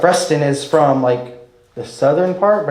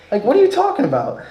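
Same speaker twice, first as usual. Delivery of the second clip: usual speaking voice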